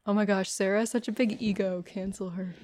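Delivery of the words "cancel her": unvoiced mock whisper. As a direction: hush it like a secret verdict.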